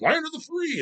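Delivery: sarcastic mock quote. Mocking the national slogan.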